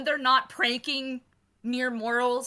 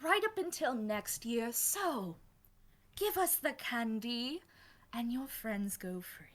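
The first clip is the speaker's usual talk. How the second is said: softer, quiet menacing bargain; soft threat; 3 semitones higher, 5 dB quieter than usual. Acted. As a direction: hush it down to a whispered ultimatum. GM voices vampire threat.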